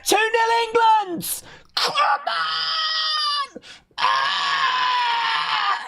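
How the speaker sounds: screaming high with joy; goal celebration. Real, not acted. Streamer celebrates England's goal, screaming high. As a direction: erupt like your team just scored.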